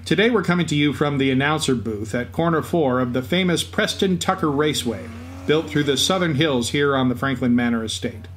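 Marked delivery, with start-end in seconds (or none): none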